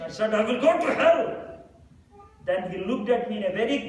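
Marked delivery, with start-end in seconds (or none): 0.3-1.2 raised, louder frustrated retort; frustrated outburst. Retold or imitated. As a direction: snap it out in frustration, higher and louder. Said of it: "Out of frustration".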